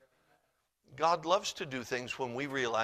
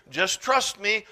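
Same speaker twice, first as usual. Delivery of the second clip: insistent; 6 semitones higher, 9 dB louder, slower than usual. Shown as a demonstration.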